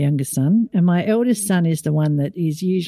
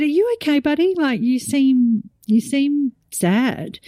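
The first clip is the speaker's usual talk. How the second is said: concerned mum; 6 semitones higher than usual. Retold or imitated. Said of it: "And I said".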